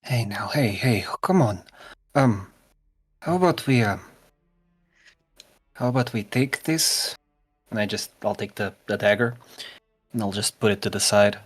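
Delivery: gentle comforting. Acted. Grom comforts crying friend.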